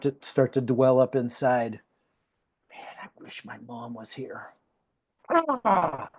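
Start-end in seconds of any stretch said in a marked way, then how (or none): 2.7-4.5 very soft inner thought, wishing; wistful murmur to himself. Retold or imitated. Inner thought on his wedding day. 5.3-6.1 high, falling "ah" of frustration; mock cry of a sudden pang, falling away; pained exclamation. Retold pang at wedding.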